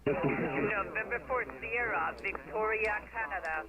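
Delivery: mistuned ham radio. Real, not acted. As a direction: sideband voice tuned slightly off. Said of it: "your audio is distorted or you're a little off frequency".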